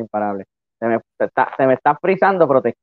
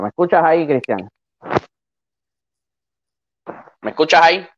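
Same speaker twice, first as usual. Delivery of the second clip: raised, higher calling voice; to someone hard of hearing; 5 semitones higher than usual. Real, not acted. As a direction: call out over a bad connection. Checking if Cristian hears him.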